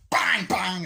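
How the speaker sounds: loud, high "bang" for each shot; explosive spoken sound effect; imitated gunshots. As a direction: act out gunfire with punchy bangs. Imitates gunfire in the scene.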